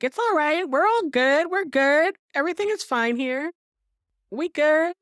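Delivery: squeaky fake-cheerful everything's fine; hammed-up high voice calming the guard; mock Suzanne reassuring.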